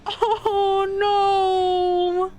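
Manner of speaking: high crying oh no; real grief pouring out; heartbroken. Real, not acted. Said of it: "I'm so heartbroken".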